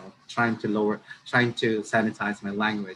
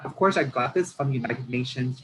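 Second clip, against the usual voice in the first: ordinary talk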